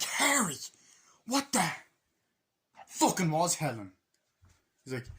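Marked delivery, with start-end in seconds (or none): 0.0-3.9 higher, louder angry wife; hammed-up shocked wife; mock outraged wife. Wife confronts husband.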